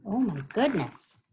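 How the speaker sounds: usual speaking voice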